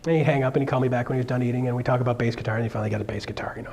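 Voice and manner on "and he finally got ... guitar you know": whisper. Real, not acted. Aside while telling story.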